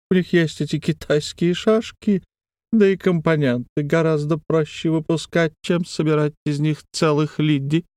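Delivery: a thin eerie wheedle; thin wheedling voice. A narrator's reading. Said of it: "забринчал голос Броуза".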